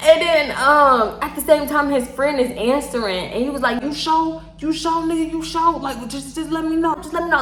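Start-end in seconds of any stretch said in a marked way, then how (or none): 3.8-7.4 higher-pitched, repetitive pestering; hounding. Retold or imitated. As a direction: imitate a friend pressing for an answer. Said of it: "his friend was kinda hounding him".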